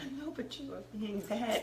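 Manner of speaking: very soft whispered scolding; whispering on the phone. Real, not acted. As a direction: hush it, barely voiced. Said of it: "Why are you whispering?".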